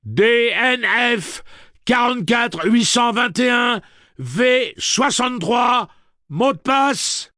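high, slow yelled serial number; loud, drawn-out command; shouted read-out. A narrator's reading. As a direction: shout out each figure deliberately. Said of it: "il le lut à haute voix".

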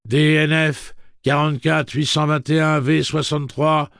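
high, loud, slow machine monotone; monotone robot voice. Acted. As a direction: read it like an amplified recording. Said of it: "d'une voix monocorde et amplifiée".

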